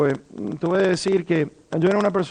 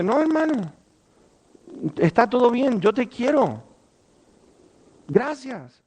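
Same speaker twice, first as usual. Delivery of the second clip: loving reassurance; 5 semitones higher than usual. Retold or imitated. Brother forgives him.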